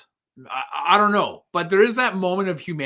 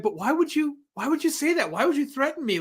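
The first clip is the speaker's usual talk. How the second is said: higher, pleading, almost whimpering; frightened oilman; 10 semitones higher than usual. Retold or imitated. Oilman pleading with a threatening Plainview, higher and frightened. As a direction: beg him to explain the threat. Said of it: "when the guy's like".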